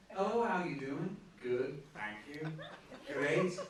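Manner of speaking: a light mock posh elocution accent, each phrase neat; prim, refined elocution accent, gently mocked. After elocution lessons, a mild mock posh English accent. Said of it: "they talk like that".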